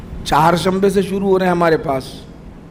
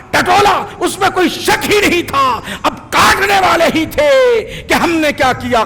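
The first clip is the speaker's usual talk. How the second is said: high, impassioned sermon voice proclaiming God's words; fiery preaching; 7 semitones higher than usual. Acted. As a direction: build it up, climbing higher with each line. God's voice, preaching at full force.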